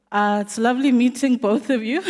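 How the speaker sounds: loud laughing greeting. Real, not acted. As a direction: beam and laugh as you say it.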